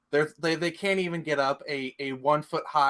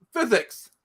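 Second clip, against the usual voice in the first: exclaimed, higher-pitched movie quote; Patrick Stewart quote; 7 semitones higher, 4 dB louder than usual. Retold or imitated. Host quoting a funny X-Men scene, exclaimed mock line. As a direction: call it out like a comic movie line. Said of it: "Patrick Stewart's like".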